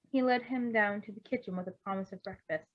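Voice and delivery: ordinary delivery, nothing marked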